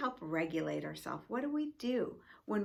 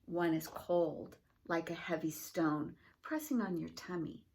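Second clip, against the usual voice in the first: story reading; 2 semitones lower than usual. Acted. Principal reading a picture book to school families, gentle storytelling. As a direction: read it like a bedtime picture book.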